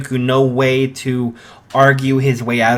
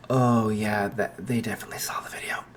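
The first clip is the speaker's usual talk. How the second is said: muttered aside; 3 semitones lower, 8 dB quieter than usual. Acted. Izuku muttering to himself.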